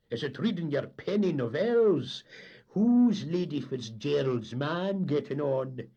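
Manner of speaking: loud, high, slow teasing; mocking jibe. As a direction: needle him with sarcasm. Captain mocks engineer.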